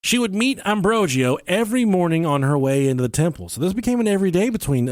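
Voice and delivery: ordinary delivery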